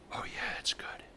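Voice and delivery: hushed delighted whisper. Real, not acted. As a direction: breathe it out, savoring it. Savoring a win, hushed.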